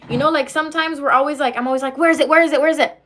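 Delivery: usual speaking voice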